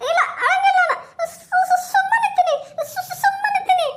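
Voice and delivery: very high, half-sobbing, stammering woman; tearful woman. Acted. A frightened woman promising the ghost she will stop crying, high mock sobbing.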